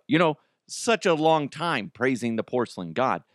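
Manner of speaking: ordinary talk, nothing marked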